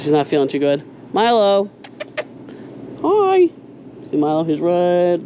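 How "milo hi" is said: high, bright greeting to a pet; calling the dog. Real, not acted. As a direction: call out happily to the dog.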